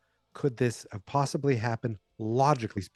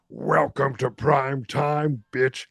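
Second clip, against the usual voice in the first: slow, loud, drawn-out villain line; Freddy Krueger taunt; 3 semitones higher, 4 dB louder, much slower than usual. Retold or imitated. Freddy Krueger gloating at Jennifer, slow and loud. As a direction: savour each word like a gloating killer. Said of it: "and he goes".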